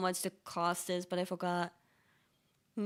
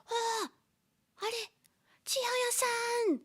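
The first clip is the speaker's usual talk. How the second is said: high, slow delighted call; excited greeting; 13 semitones higher than usual. Retold or imitated. Streamer spotting Chihaya at her bike, high excited call. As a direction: call out her name in happy surprise. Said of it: "I was like".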